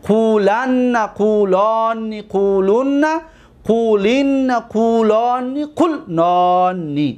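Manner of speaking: slow chant-like drill with held syllables; paradigm recitation. Shown as a demonstration. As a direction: recite each form drawn out, leaning on the doubled n.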